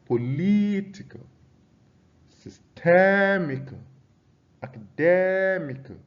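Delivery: "Political. Systemical. Academical." with LIT, TEM, DEM high and stretched; stress on third-last syllable. Shown as a demonstration. Teacher repeating examples for the class, stressed syllables high and long. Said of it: "stress on the third syllable from the end".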